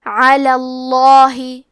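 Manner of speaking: اللہ with a full, heavy, velarized lam, recited slowly. Shown as a demonstration. Teacher shows the heavy lam of اللہ in tajweed. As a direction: recite علی اللہ slow with a thick lam in اللہ. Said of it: "حرف اللام کو موٹی آواز میں پڑھیں گے".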